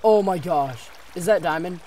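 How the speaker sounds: loud, high excited gasp; thrilled to spot diamond. Real, not acted. Spots diamond in mine.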